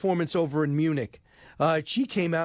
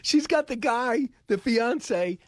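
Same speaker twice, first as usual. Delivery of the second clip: much higher, slow, gleeful mock amazement; gleeful delight; 8 semitones higher, slower than usual. Real, not acted. Host gloating to viewers about the snoring fiance, gleefully high. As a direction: relish the absurdity, pitch way up. Mocking AOC clip with snoring.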